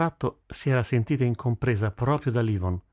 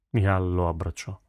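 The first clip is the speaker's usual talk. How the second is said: usual speaking voice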